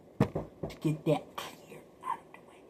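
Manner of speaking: hushed whisper. Real, not acted. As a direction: murmur it as an aside. Whispered aside.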